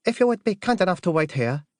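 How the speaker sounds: high-pitched, deferential butler voice; formal butler. Acted. Butler to an unexpected caller, high formal servant voice. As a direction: politely turn the caller away, pitched well up. Butler asks the visitor to wait.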